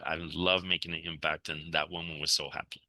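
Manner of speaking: normal talking voice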